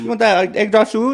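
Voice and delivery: put-on high, loud caricatured Chinese accent; mock Chinese accent. Guest doing an accent on request, mock Chinese. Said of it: "a Chinese a little bit".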